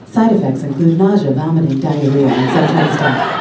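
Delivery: understated commercial voiceover; lightly voiced ad read. Shown as a demonstration. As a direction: read the side effects like a mild drug commercial. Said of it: "they hired me to say things like".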